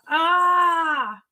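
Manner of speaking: high drawn-out cry of dismay; mock wail. Real, not acted. Streamer groaning at the broken charger, mock cry. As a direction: let out a pained ah.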